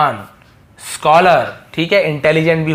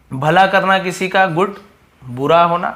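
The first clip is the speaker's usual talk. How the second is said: ordinary talk, nothing marked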